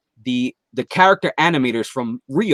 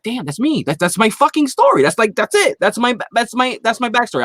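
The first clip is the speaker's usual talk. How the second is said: excited realization; 8 semitones higher than usual. Retold or imitated. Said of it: "I'm like".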